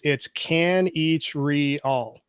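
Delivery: "Kanichirol" said slowly, syllable by syllable; name pronunciation. Shown as a demonstration. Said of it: "how do you pronounce your s-, last name".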